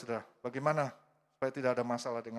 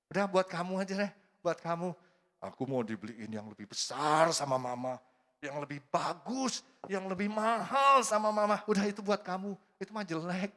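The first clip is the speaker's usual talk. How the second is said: put-on smug child; 5 semitones higher, 3 dB louder than usual. Child telling the neighbour's kid to keep the toy, smug and boasting. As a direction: brag like a spoiled little kid.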